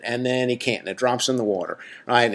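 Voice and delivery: ordinary delivery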